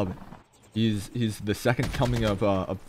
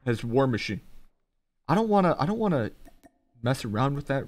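ordinary talk, nothing marked

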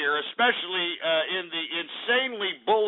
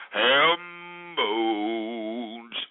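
both words drawn out long and low; mock-grand drawl; 9 semitones lower than usual. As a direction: stretch HAM BONES slowly on a low note. Mock announcing of ham bones.

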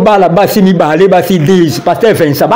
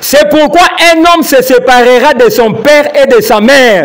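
Bible reading; 6 semitones higher than usual. Acted. Co-host reads the verse aloud to the pastor, slow and solemn. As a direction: proclaim the verse slowly from the pulpit.

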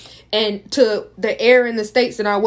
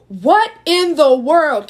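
slow, higher, louder what in the world; stunned disbelief; 5 semitones higher, 5 dB louder, much slower than usual. Retold or imitated. Told she cheated, she answers in slow shocked disbelief. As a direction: drag it out, shocked.

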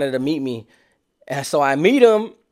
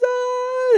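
much higher mock crying wail; a hammed-up fake sob. Carjackers faking tears in Taco Bell, higher mock wail. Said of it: "They started crying".

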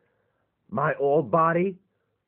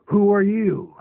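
startled demand; 4 semitones higher, 7 dB louder than usual. Acted. Robot wakes, sees stranger.

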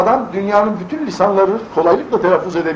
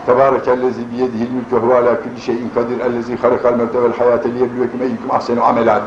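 low, measured Arabic recitation; Quran recitation; 7 semitones lower than usual. Shown as a demonstration. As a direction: recite the verse as in prayer. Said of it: "demeli ya".

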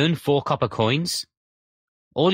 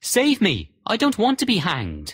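cries out; 7 semitones higher than usual. Acted. Soldier at the gallows begging his dogs, desperate cry. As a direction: beg for your life at the gallows. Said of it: "the soldier cried out".

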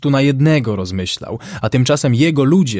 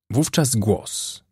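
ordinary delivery, nothing marked

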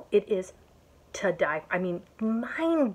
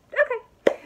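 much higher and louder; high giddy okay; 7 dB louder than usual. Real, not acted. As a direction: squeak it out. Snapping out of a laugh.

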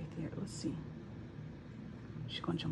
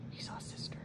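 whisper; 14 dB quieter than usual. Real, not acted. Whispering while watching cats.

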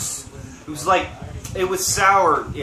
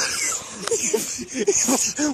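burst of laughter; 7 semitones higher, 5 dB quieter than usual. Real, not acted. Laughing after being punched.

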